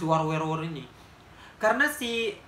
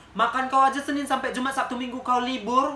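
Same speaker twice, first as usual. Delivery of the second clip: louder, higher taunting jab; taunting a beggar with a loud, higher jab; loud mocking insult; 7 semitones higher, 6 dB louder than usual. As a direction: taunt her loudly.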